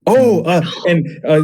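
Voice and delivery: usual speaking voice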